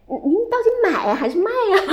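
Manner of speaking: confused laughing question. Retold or imitated. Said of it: "别人问他".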